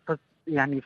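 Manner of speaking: ordinary talk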